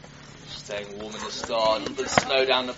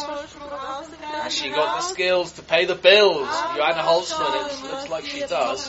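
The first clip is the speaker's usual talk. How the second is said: excited high call; 12 semitones higher, 4 dB louder than usual. Real, not acted. Tight race, leader chasing.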